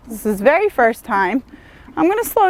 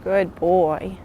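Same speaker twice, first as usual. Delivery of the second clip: soft praise; 5 semitones lower, 5 dB quieter than usual. Real, not acted. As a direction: soothe the horse quietly. Praising horse during lunging.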